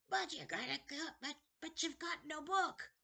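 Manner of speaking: put-on high, eerie old man's voice; a wheedling ancient keeper of books; creepy old librarian. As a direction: play a strange, eager old librarian. Librarian character voice.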